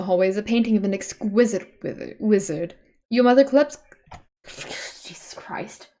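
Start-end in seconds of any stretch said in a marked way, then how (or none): 5.0-5.9 breathy, shocked under-the-breath reaction; appalled whisper. Real, not acted. Streamer whispers in dismay at the awful painting. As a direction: mutter it in horror. Recoils at the ugly wizard painting.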